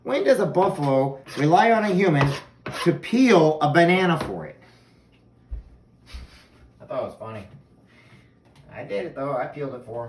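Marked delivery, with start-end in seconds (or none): 0.0-4.6 high, loud disbelief; incredulous. Retold or imitated. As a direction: exclaim in amused disbelief. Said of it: "I'm like".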